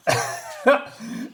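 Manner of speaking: real laughter that breaks up the speech; helpless laughter. Real, not acted. Helpless real laughter. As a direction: recapper cracking up while retelling the scene. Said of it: "sorry, I'm cracking up".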